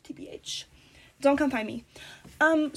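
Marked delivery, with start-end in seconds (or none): 0.0-0.7 whisper. Real, not acted. Whispered aside about address.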